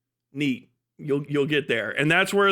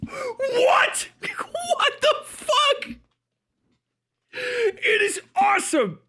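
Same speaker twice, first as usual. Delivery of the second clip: shrieking laughter; much slower than usual. Real, not acted. Reacting to a video in disbelief.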